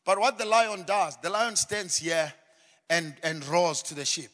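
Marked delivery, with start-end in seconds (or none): none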